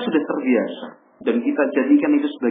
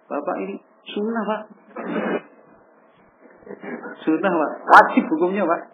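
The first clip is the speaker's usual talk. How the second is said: insistent, raised-pitch preaching at father; a son insisting to his father that it is obligatory, pitch raised; blunt lecturing son; 7 semitones higher than usual. Acted. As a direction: press the rule on him, pitch up.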